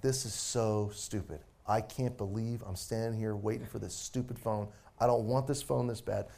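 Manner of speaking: low, subdued grumble of complaint; bad attitude. Man stuck in the phone line grumbling to himself, bad attitude. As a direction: grumble it under your voice, low and soft. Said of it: "I got a really bad attitude".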